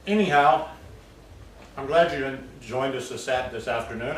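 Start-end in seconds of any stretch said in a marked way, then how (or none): none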